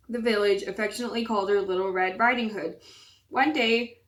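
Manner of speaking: ordinary delivery, nothing marked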